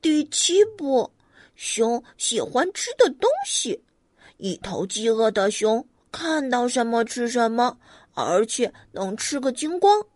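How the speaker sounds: lightly voiced rabbit reading; rabbit reading aloud. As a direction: read the rules like a serious little rabbit. Rabbit reads page seven.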